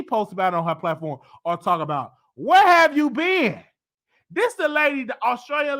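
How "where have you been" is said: mock disbelief. Mocking question to Iggy.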